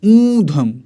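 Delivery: ऊधम high and loud, the ऊ stretched; emphatic long oo. Shown as a demonstration. Teacher drilling the class, loud and high. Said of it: "इस सब में बड़ा ऊ रहता है".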